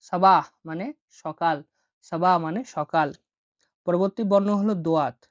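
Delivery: usual speaking voice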